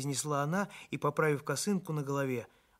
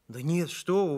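raised, louder protest; exclaiming; 5 semitones higher, 6 dB louder than usual. A narrator's reading. Doctor contradicts the modest baker, exclaiming. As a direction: protest with lively emphasis. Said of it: "воскликнул я".

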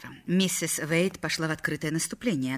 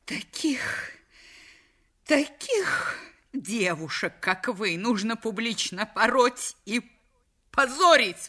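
exclaiming; 5 semitones higher, slower than usual. A narrator's reading. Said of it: "воскликнула миссис Вейт".

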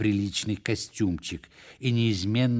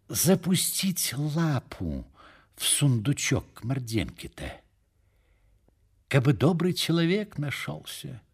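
sly hinting; 6 semitones higher than usual. A narrator's reading. Иван Иванович hints at robbing Морденко's chest, sly hinting. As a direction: float the idea craftily, to nobody in particular. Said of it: "прицмокнув языком, схитрил Иван Иванович".

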